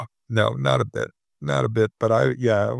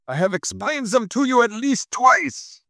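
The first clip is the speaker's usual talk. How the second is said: put-on German accent; 13 semitones higher than usual. Continues German accent quote.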